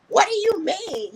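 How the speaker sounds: high-pitched indignant question; retold upset. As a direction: imitate her getting worked up, pitch leaping. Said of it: "immediately got up, like, upstirred".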